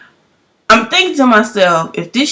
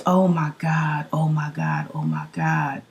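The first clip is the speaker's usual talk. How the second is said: stunned; 6 semitones lower, 9 dB quieter than usual. Retold or imitated. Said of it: "she was just".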